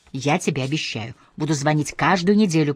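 ordinary delivery, nothing marked

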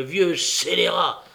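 loud, high, accusing tirade; heated outburst climbing to a high challenge; exasperated. A narrator's reading. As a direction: hurl the charge and demand an answer. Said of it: "s'écria Nancy exaspérée".

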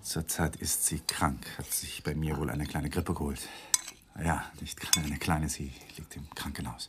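softly, almost whispered; hushed confiding. Acted. Husband telling the guest his wife is ill, hushed. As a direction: drop the voice as if guarding a secret.

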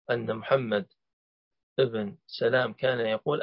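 normal talking voice

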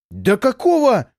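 enraged, drawn-out snarl; furious outburst. Acted. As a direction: spit it out in rage. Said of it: "Не помня себя от ярости".

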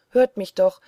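loud call. A narrator's reading. Peter begs the hidden little man to listen, loud call. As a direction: call out to be heard. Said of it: "rief er laut".

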